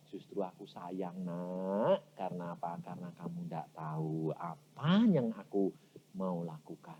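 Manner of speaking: low, soft fatherly voice; God's loving answer. Acted. God answering the beloved child, low soft reassurance. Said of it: "Tuhan jawabnya apa?".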